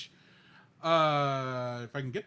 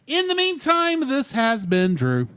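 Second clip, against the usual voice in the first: loud, high, drawn-out sign-off; sign-off announcer; 4 semitones higher, 12 dB louder, slower than usual. Acted. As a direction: sign off like a radio host. Show sign-off catchphrase.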